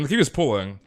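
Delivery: normal talking voice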